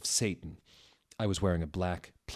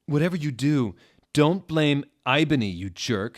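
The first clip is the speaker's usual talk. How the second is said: indignant shout; 7 semitones higher, 8 dB louder than usual. Acted. Satan shouting at Dumbledore.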